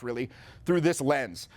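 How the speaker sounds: ordinary talk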